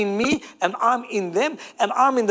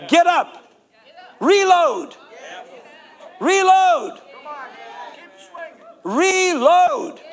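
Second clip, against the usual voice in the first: rallying shout; 6 semitones higher, much slower than usual. Acted. Pastor rallies the congregation.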